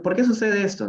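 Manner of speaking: normal talking voice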